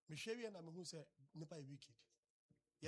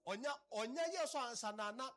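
raised, louder voice of an outraged parent; acted parental scolding; 4 semitones higher, 4 dB louder than usual. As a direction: scold the child, pitched up and forceful. Acted parent answers child with slap threat.

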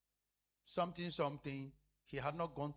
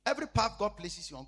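usual speaking voice

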